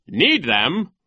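loud, high questioning echo; incredulous question. A narrator's reading. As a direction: query it in disbelief. Nero questions the villain.

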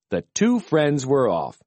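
usual speaking voice

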